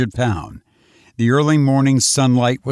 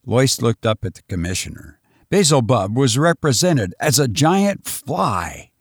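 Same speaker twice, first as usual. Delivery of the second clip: ordinary delivery, nothing marked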